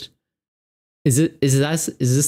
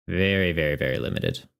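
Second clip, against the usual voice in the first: very limited dropped much lower and softer; low muttered repeat; 6 semitones lower, 3 dB quieter than usual. Real, not acted. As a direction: sink the voice right down on very limited. Repeating to stress limited draw.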